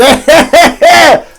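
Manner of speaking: high, loud put-on cackle; hammed-up jolly laugh held on a high note; mock villager laugh. Gladys laughs after her small talk with Postie, hammed-up.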